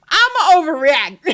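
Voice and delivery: hammed-up declaration, laughing; overreacting. Mocking husband who overreacts, loud and laughing. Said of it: "he's from zero to a hundred".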